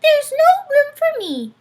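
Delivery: tiny mouse complaining; squeaky mouse, indignant. A narrator's reading. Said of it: "squeaked Mortimer".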